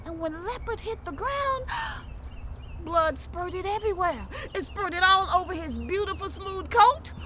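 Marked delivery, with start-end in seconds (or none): 1.7-2.8 high unvoiced gasp. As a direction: gasp at the blood. Leopard hits the ground.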